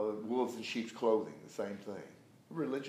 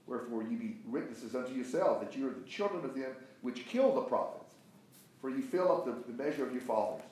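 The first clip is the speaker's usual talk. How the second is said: higher-pitched preacher reading, swelling on stressed words; emphatic scripture reading; 3 semitones higher than usual. Acted. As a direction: read the rebuke out with force. Reads scripture forcefully to class.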